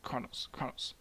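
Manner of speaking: whispered call for someone's attention; hushed, voiceless psst; conspiratorial whisper. Acted. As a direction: whisper the name like a secret summons. Mother secretly calls her son.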